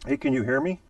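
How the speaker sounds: ordinary delivery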